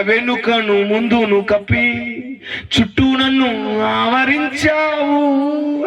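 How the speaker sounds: a verse drawn out very slowly; slow recitation. Acted. Preacher recites a psalm to the congregation, very slow and deliberate. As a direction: linger over each word of scripture.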